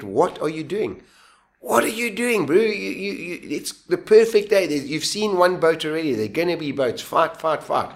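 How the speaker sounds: high-pitched pleading pep talk; urgent self-scolding. Retold or imitated. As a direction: talk yourself out of giving up, acted out. Acts his brain scolding himself.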